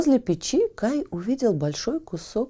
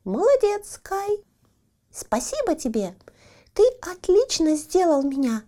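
high, thin squeaky little voice; 7 semitones higher, 3 dB louder than usual. A narrator's reading. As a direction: squeak it out like a tiny coal man. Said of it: "тонкий писклявый голосок".

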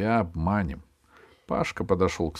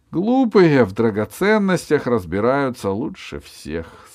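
rat man; 5 semitones higher, 8 dB louder than usual. A narrator's reading. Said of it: "сказал крыс".

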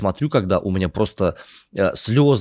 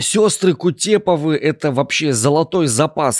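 excited; 8 semitones higher, 4 dB louder than usual. Real, not acted. Passionately defending the actresses.

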